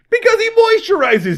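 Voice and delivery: high, loud mock outrage; exasperated comeback. Acted comeback to Doodles' suspicion.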